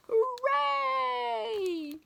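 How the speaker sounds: high cheering shout. Acted. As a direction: cheer like a crowd of kids. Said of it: "shouted all the kids".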